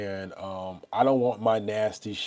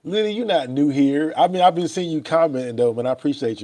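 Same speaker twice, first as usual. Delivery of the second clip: higher and louder shout-out; excited greeting; 6 semitones higher, 8 dB louder than usual. Real, not acted. Greeting Lily, a regular commenter, with an excited shout-out. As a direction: call her name out happily.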